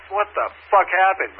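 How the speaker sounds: high, drawn-out exasperated question; incredulous retold complaint; retold anger. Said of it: "I was fucking pissed".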